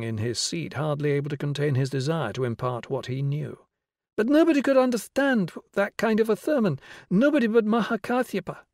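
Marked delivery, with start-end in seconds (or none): 4.2-8.6 put-on high, eager child voice; keen boy showing off. Eager boy continuing excitedly.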